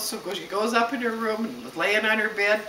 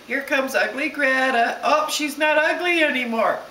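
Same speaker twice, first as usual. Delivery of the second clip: higher, louder mock schoolyard taunt turning to surprise; teasing kids; 7 semitones higher, 5 dB louder than usual. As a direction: play kids taunting, then caught out, pitched up. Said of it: "the kids started saying".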